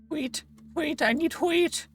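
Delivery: high squeaky voice, slow. Pretend.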